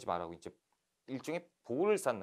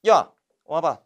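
louder call to come here; loud summons; 3 semitones higher, 14 dB louder, slower than usual. Retold or imitated. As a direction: summon them with authority. New envoy summons officials. (관리들을 부르는 안핵사, 크게 호통)

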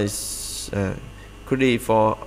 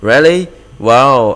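surprised; 3 semitones higher, 10 dB louder, slower than usual. Acted. Reading a dialogue aloud.

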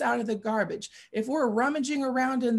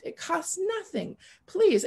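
pleading; 7 semitones higher than usual. Retold or imitated. Begging the homeless man.

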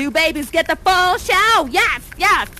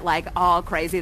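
ordinary talk

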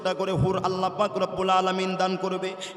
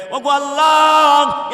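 slow, much louder wailing call to God; long drawn cry; 7 semitones higher, 12 dB louder, much slower than usual. A narrator's reading. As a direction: stretch the cry out loud and slow. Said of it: "আল্লাহ পাক রব্বুল আলামিনের কাছে বলবে".

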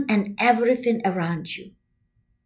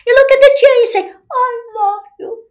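rising excitement; 10 semitones higher, much faster than usual. Shown as a demonstration. Teacher gushing love at a chair, high and excited. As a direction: gush it at the chair, voice lifting.

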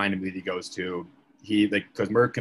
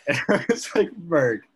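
laughing exasperation; 7 semitones higher, 4 dB louder than usual. Retold or imitated. Exasperated reaction to surprise.

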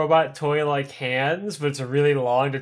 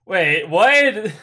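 sharp, louder, disbelieving double take; surprised; 5 semitones higher, 6 dB louder than usual. Real, not acted. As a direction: react like you misheard. Reacting to eight million leaks.

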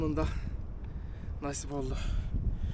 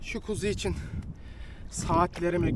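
usual speaking voice

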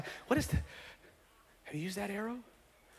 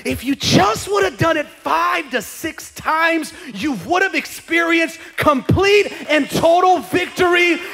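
louder, higher, slow scolding; God's frustrated voice; 9 semitones higher, 7 dB louder, slower than usual. Retold or imitated. God scolding believer for settling, slow and loud. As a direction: plead hard as a frustrated God. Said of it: "God's like".

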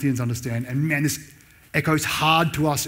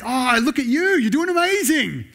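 much higher, gushing mock admiration; sarcastic praise; 10 semitones higher, 3 dB louder than usual. Paul teasing proud Corinthians, much higher, gushing sarcasm. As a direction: lay the fake praise on thick. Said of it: "That's the sarcastic reading".

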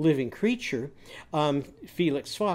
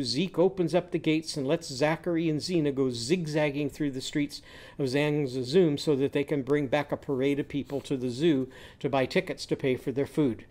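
ordinary delivery

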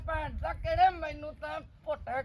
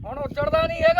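much higher, loud exasperated yell; 6 dB louder, faster than usual. Acted. Scene: fed-up father yelling at his son to leave, exasperated. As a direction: shriek at him to finally get lost.

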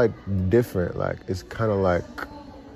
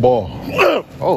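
sudden high startled shout; startled yelp; 8 semitones higher, 6 dB louder than usual. Real, not acted. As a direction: jump back with a high yelp. Jump scare reaction.

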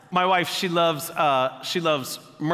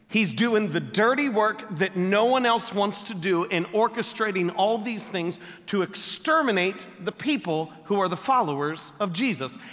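ordinary talk, nothing marked

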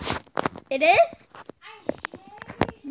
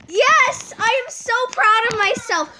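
normal talking voice

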